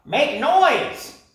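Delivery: high, loud parrot shout. Acted. Parrot shouting at the creatures to make noise. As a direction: shout the order. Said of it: "he shouted".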